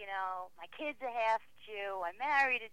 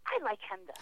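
put-on child's voice; 9 semitones higher than usual. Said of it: "and he said".

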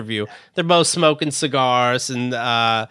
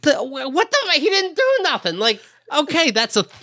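much higher, incredulous what-the; baffled Bill Burr; 12 semitones higher than usual. Retold or imitated. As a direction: squeak out total disbelief. Bill Burr's baffled reaction.

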